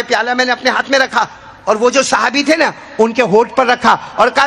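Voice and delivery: usual speaking voice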